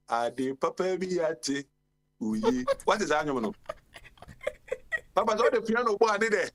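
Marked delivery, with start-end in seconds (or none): 5.1-6.5 excited. Real, not acted. Raving about piano in hymns.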